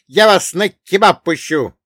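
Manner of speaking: high, loud, outraged yelling; hollering dwarf. Acted. Dwarf carried under a captor's arm, hollering. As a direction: yell to be let go, high and loud. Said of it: "драл горло карлик".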